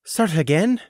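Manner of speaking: "Start again?" asked in high, thrown surprise; startled question. A narrator's reading. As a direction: caught off guard by "Start again?". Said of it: "sounding thrown".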